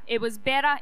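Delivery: ordinary delivery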